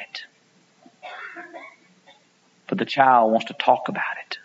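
soft unvoiced whisper; hushed aside. Real, not acted. Preacher whispering about parents laughing at a child's zit.

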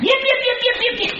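high thin yaps like a little dog; dog yipping. Retold or imitated. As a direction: yap like a tiny lapdog. Imitating dog at night.

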